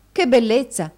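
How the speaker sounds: high, loud delight; delighted wife's exclamation; exclaiming. A narrator's reading. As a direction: burst out with admiration. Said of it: "esclamò Madama".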